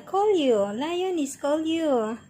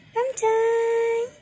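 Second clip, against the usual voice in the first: high cooing call; 10 semitones higher, 3 dB louder than usual. Real, not acted. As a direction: call the cat up high and sweet.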